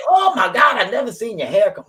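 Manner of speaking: high, loud mock astonishment; amazed. Retelling Mister Duke's reaction to her hair, amazed. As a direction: imitate someone stunned, pitch jumping up.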